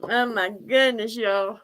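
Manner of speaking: usual speaking voice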